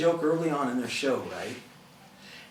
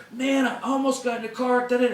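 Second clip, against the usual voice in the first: higher-pitched imitation of an upset friend; mock her agitated complaint, pitched higher; upset caller; 8 semitones higher than usual. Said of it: "they were so ticked off".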